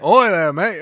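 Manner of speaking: loud Scouse "Oi there, mate"; Liverpool accent. Shown as a demonstration. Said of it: "as soon as you get to, like".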